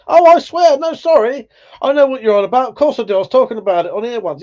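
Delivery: high-pitched burst of realisation; excited, apologetic "oh, that one!"; sudden recognition. Real, not acted. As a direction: jump up in pitch as it clicks, apologetic. Sudden recognition of the film meant.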